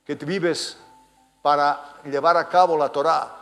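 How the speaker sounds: loud, high outbursts with pauses between; incredulous rebuke. Acted. Jesus to Nicodemus, the teacher of theology, loud incredulous rebuke. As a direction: hurl it as an accusing challenge.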